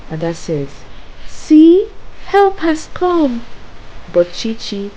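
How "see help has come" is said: high, loud burst of relief; hopeful, much higher voice; relieved sister. A narrator's reading. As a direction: exclaim it high, as if rescue is here. Ada relieved that help arrived.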